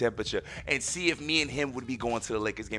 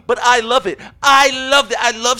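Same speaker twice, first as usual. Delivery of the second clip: gleeful; 11 semitones higher, 13 dB louder than usual. Real, not acted. Gleeful about fooling his son.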